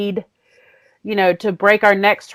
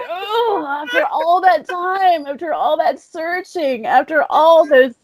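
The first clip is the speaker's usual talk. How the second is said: high, wailing comic lament; mock anguish; 7 semitones higher, slower than usual. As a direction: bemoan wasted effort, hammed-up and tearful. Mock lament over wasted search.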